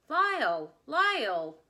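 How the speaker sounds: loud call across a crowd, falling at the end. Acted. Old stage partner calling to Lyle across the crowd, loud call. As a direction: call his name loud across the store. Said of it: "the voice called out".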